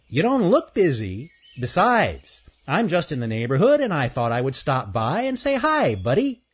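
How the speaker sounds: high, chatty chipmunk voice; teasing chipmunk. Acted. Chipmunk drops by to pester the squirrel, high breezy voice.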